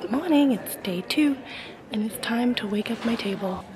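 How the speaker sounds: unvoiced whisper at the table. Real, not acted. Vlogger setting up her table, whispering. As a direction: whisper it so no one hears.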